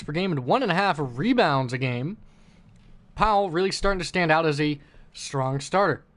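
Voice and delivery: sports broadcaster hyping the matchup; announcer voice. Pretend. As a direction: call it like a TV game intro. Mock broadcast game intro.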